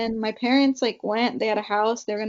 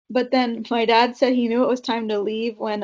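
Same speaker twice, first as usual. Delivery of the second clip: usual speaking voice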